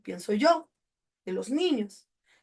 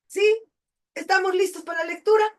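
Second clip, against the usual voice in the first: cheery teacher call; 8 semitones higher, 4 dB louder, faster than usual. Real, not acted. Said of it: "rallying class".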